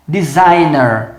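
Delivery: Designer said slowly with a silent g; slow model word. Shown as a demonstration. Teacher showing students the silent G in Designer. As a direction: draw out Designer as di-zai-ner, g silent. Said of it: "O G é mudo".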